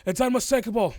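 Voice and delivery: high, loud gruff dwarf accusation; dwarf accusing. Acted.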